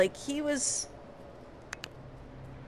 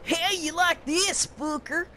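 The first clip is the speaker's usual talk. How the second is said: higher, lightly voiced hillbilly villain taunt; generic bad guy taunt; 5 semitones higher than usual. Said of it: "he was doing this".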